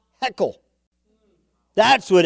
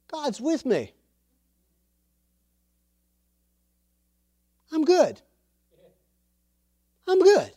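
put-on higher, boyish and sure of himself; David brushing off Saul's worry, much higher and confident; young David's confident voice; 8 semitones higher than usual. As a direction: voice a fearless young shepherd boy. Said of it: "He says".

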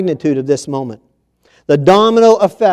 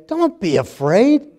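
high, lifted angel reassurance; hammed-up high heavenly plea; 11 semitones higher, 3 dB quieter than usual. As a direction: rise up into an otherworldly angel tone. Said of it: "they have to say".

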